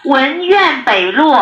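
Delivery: drawn out, each syllable clear; slow and deliberate. Real, not acted. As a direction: spell it out slowly for the caller. Dispatcher repeats slowly to caller.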